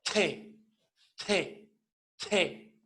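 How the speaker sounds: T con soplo de aire; T aspirada. Shown as a demonstration. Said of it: "Y pronunciamos". (T aspirada)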